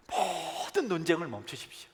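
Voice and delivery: usual speaking voice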